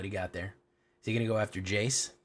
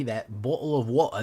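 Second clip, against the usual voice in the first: Cockney accent; 3 semitones higher, 5 dB louder than usual. Shown as a demonstration. Streamer mimicking the joke British accent, hammed-up Cockney. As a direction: joke Cockney, dropped t's in "bottle of water". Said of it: "that kind of Cockney".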